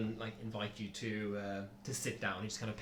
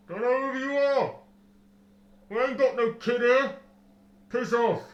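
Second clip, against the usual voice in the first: muffled voice; 11 semitones higher, 11 dB louder than usual. Acted. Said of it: "Then a muffled voice from the gatehouse".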